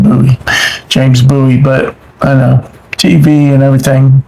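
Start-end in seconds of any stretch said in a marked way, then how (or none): 0.0-1.6 "Bowie" both times as BOO-ee; authentic pronunciation. Shown as a demonstration. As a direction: say each "Bowie" with an OO vowel, like gooey. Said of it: "That's how you really say it".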